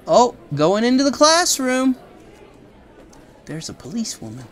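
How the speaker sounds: ordinary delivery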